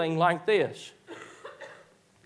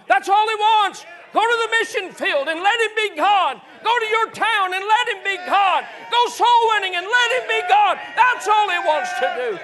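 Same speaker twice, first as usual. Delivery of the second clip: shouted high, louder preacher's cry; full-force preaching; 6 dB louder than usual. Acted. Preacher rousing the congregation to go let God be God, shouting high and loud. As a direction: ring it out to the rafters.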